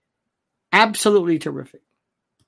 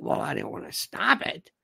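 a hint of a smug judge, softer; mock judge voice; 3 semitones lower, 9 dB quieter than usual. Host voicing judge admitting it, softer mock. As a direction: shrug it off as the judge.

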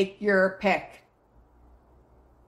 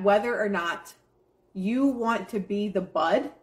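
usual speaking voice

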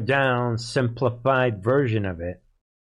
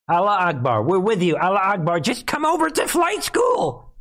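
high-pitched hammed-up handler, rising to a yell; mock urgent coaxing; 6 semitones higher than usual. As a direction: coax the recruit, building to a mock shout. Acting out handler coaxing a recruit.

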